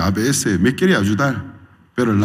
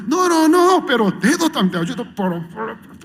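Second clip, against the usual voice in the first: mock protest; 12 semitones higher, 3 dB louder than usual. Protesting needle in fingers.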